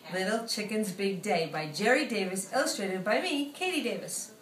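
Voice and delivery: usual speaking voice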